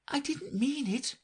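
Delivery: put-on pleading girl. A narrator's reading. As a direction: beg forgiveness gently. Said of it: "pleaded poor Alice".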